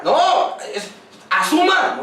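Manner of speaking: a lightly acted parent demanding why; mock scolding parent.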